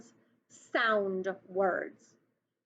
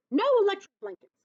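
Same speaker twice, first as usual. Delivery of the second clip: higher, fast stern farmer; farmer's refusal; 9 semitones higher, 4 dB louder, much faster than usual. Acted. Farmer Brown flatly refusing the cows, higher and quick. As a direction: snap it as the annoyed farmer.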